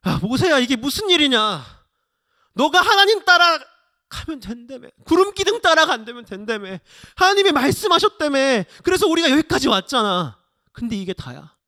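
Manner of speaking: high, loud accusing outcry; angry resentful complaint. Acted. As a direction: confront the leader as a betrayed crowd. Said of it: "사람들이 이렇게 원망하는 거예요".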